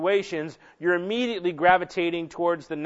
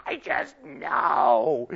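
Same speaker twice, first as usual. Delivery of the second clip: imitated wife; 8 semitones higher, much slower than usual. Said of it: "She goes".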